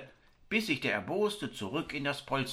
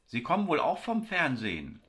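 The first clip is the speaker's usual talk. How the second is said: lurking, biting question; 6 semitones higher, 3 dB louder than usual. A narrator's reading. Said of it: "fragte sie lauernd und mit Biss".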